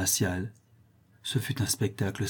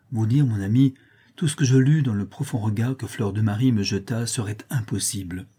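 plain reading voice

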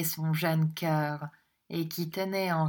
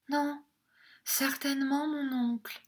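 tearful; 9 semitones higher than usual. A narrator's reading. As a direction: answer meekly through tears, high and small. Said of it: "répéta la jeune fille en pleurant". (en pleurant, voix aiguë et douce)

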